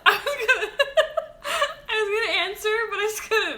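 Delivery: high voice breaking into real laughter; laughing too hard. Real, not acted. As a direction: try to talk through helpless giggles. Cracked up by the therapist act.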